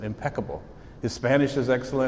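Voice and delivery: usual speaking voice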